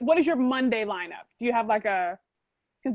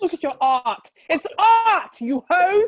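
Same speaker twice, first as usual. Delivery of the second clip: high, loud theatrical art snob; mock outrage; 6 semitones higher, 8 dB louder, slower than usual. Host hamming it up about the guest's art, mock-grand outburst. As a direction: proclaim it grandly, playing it up for laughs.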